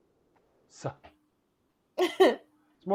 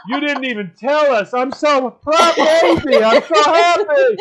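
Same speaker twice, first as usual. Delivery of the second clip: high, loud, slow gushing; gushing parents; 6 semitones higher, 6 dB louder than usual. Retold or imitated. Imitating parents gushing at the proposal, high and loud. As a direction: swoon over the news. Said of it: "the parents are going".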